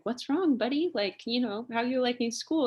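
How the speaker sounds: imitating dad gently questioning his son; dad checking in. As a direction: lift the pitch, asking a little kid what's up. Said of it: "and my dad's like".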